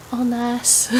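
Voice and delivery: put-on light playful Southern US accent. Showing off hairdo to viewers in a light Southern accent. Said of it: "That's my southern accent".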